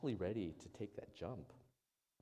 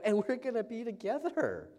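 higher, louder happy exclamation; excited; 13 semitones higher, 8 dB louder than usual. Retold or imitated. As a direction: share the joy of the wedding day. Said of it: "And I go".

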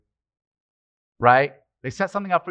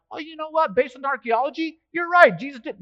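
higher mock voice of a skeptic giving in; mock convert; 11 semitones higher than usual. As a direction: play a won-over debater, tongue in cheek. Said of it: "not one person's like".

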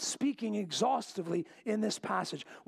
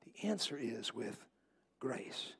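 hushed answer; 9 semitones lower, 9 dB quieter than usual. Acted. Sermon climax, softly delivered.

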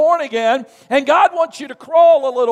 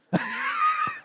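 high burst of laughter; 8 dB quieter than usual. Real, not acted. Laugh after punchline.

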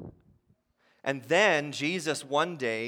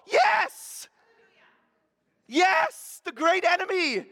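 high, loud joyous shout; triumphant whoop from the congregation; jubilant cheer; 10 dB louder, slower than usual. Pretend. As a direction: cheer like your team just won. Imagined cheer at death's defeat.

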